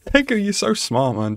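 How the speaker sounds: high-pitched, laughing admiration; tickled, squeaky disbelief; amused amazement. Real, not acted. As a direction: gush through a laugh.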